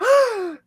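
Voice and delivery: sharp, high startled gasp; waking gasp. Retold or imitated. Dreamer shows coworkers how she woke up, startled gasp. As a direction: imitate jolting awake with one big gasp. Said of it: "I woke up, like".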